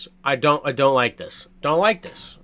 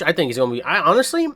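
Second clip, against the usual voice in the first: usual speaking voice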